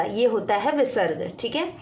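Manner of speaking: ordinary delivery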